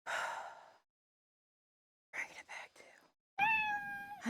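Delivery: winded, whispering; breathless whisper. Real, not acted. Streamer winded from the stairs, softly whispering. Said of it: "I know I'm winded".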